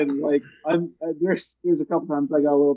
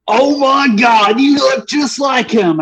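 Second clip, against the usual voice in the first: loud, high, drawn-out exclamation; mock loudest coworker; 9 semitones higher, 6 dB louder, slower than usual. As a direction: imitate a loudmouth hollering in astonishment. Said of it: "tells the most loudest person that works".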